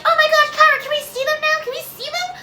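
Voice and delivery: very high, over-excited fan imitation; mock fan squeal. Vlogger imitating fans begging to see the characters, squealing. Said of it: "you guys are gonna be like".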